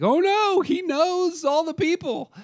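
a sudden audience panic, voice jumping way up; alarmed realization. Retold or imitated. Audience realizing the villain knows every name, in high alarmed dread. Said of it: "we're like".

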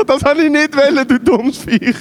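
high-pitched mock exasperation at an animal; retold scolding, pitched well up; exasperated outburst. Acted cry at runaway sheep.

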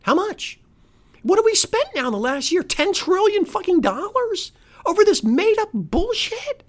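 high-pitched, indignant demand; incredulous outrage. Real, not acted. Host rants about government spending, incredulous outrage. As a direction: pitch it way up in real disbelief.

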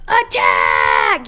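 villain barks a loud low order; yelled command. Acted. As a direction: yell it at the army. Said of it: "yelled the evil chef".

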